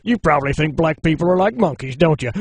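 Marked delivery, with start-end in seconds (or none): none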